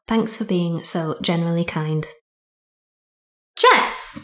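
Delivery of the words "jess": high sing-song call of a name. Real, not acted. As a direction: call her over, pitch up high. Host calls guest to mic.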